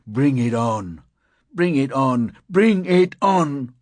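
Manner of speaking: from firm to high, loud yell; rising wild defiance; building to a roar. Acted. Reckless thought, building to roar.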